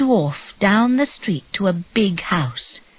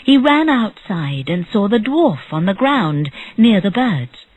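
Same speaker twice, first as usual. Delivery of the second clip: ordinary delivery, nothing marked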